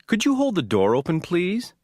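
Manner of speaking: rising, light polite ask; courteous rising please; polite request. Shown as a demonstration. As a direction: ask sweetly, lifting at the end. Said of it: "The first is polite".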